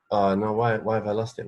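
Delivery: ordinary talk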